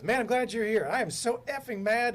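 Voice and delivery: high, loud imitation of a fuming boss; retold angry outburst. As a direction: act out someone going off in a rant. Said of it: "he just goes off again".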